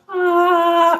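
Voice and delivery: high, drawn-out "ah"; imitated rising-trauma noise. Meditator's buried trauma hitting the brain, mock wail.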